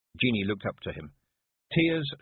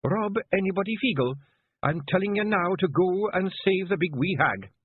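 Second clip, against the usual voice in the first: higher, firm Scots command; sharp, cold Kelder voice; 11 semitones higher, 4 dB louder than usual. A narrator's reading. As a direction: give the order like a dagger of ice. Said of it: "the sharp, cold voice of a Kelder".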